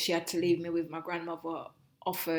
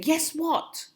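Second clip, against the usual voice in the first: higher, louder demanding mum; stern mother; 9 semitones higher, 6 dB louder than usual. Retold or imitated. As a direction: mimic mum demanding she say Mummy. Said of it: "she would say".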